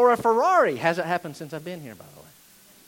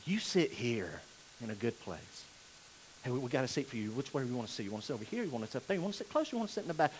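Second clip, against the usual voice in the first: much softer, lower, syrupy eager host; fawning usher; 9 semitones lower, 14 dB quieter, much faster than usual. Retold or imitated. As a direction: fuss over the rich guest in a hushed voice. Fawning over rich visitor.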